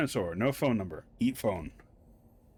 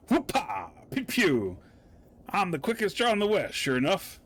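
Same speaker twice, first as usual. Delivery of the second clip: hammed-up cowboy; 6 semitones higher than usual.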